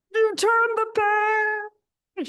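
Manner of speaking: high, very slow furry monster voice; Grover voice. Retold or imitated. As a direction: stretch it out high like a fretting Muppet. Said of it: "You know, in the Grover voice".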